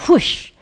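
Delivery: sudden high, loud whoosh; short, forceful whoosh noise; imitated whoosh. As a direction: make a quick rushing whoosh. Imitates a sudden rush of thought.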